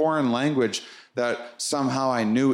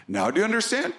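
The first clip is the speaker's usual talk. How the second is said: entity asking, high; 9 semitones higher, much faster than usual. Retold or imitated.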